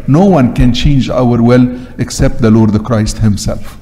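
ordinary delivery

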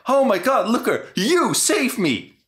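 high, slow, swooning gush of thanks; mock swooning girl. Mocking the girl gushing over Luca, in a high swooning voice. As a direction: ham up a starstruck damsel.

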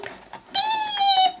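drawn-out, very high beep; scanner beep. Pretend. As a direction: hold a high electronic-style beep. Imitating the register scanner.

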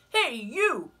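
high, loud, grumpy bug; grouchy ladybug voice. Acted. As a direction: bark the challenge up high. Ladybug challenges gorilla.

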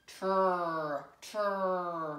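"Tur" drawn out as a blend of sounds; sounded out. Shown as a demonstration. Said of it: "sound this word out".

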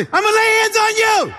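shouting to a woman over a church full of loud prayer; yelling over a crowd. Retold or imitated. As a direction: yell it to be heard over a roaring room. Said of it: "and I yell".

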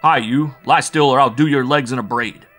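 bully the captive into lying still; rough threat. Acted. Bull Mike to the struggling prisoner, loud threat.

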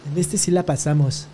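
ordinary talk, nothing marked

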